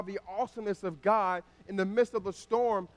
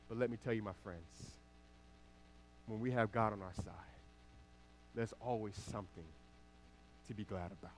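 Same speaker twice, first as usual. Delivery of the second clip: much lower and softer after the rousing questions; hushed reassurance; 7 semitones lower, 12 dB quieter than usual. Real, not acted. Preacher reassuring friends about God on our side, hushed. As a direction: drop right down and reassure them quietly.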